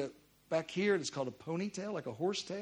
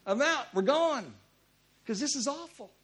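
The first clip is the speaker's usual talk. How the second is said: mock-impatient, raised-pitch call; impatient to leave; 5 semitones higher, 5 dB louder than usual. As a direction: call out that you've had enough.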